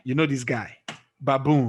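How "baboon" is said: baboon with the weight on "boon"; stress on second syllable. Shown as a demonstration. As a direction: stress the final "boon" of baboon, falling at the end. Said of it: "it's on the second".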